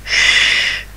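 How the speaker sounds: long hushed sh, no voice; sh held unvoiced; sh sound. Shown as a demonstration. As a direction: hiss out a soft sh. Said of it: "The sound of S H".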